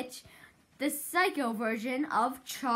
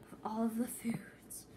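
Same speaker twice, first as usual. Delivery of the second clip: whisper; 4 semitones lower, 9 dB quieter than usual. Real, not acted. Whispered aside.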